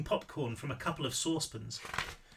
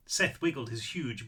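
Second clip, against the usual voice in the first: usual speaking voice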